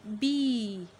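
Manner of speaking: loud, held vowel in बी; long E. Shown as a demonstration. Teacher models a long-vowel word, stretched बी. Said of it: "ई अपनी ही आवाज़ दे रहा".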